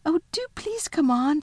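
put-on high, impatient little girl; a child begging them to hurry. A narrator's reading. Lucy impatient.

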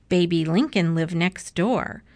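usual speaking voice

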